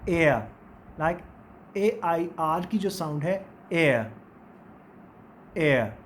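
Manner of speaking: teacher modelling the air sound; diphthong demo. Shown as a demonstration. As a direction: stretch एयर from eh into uh. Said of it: "phonetic symbols जो हैं डिफ्थॉन्ग्स में".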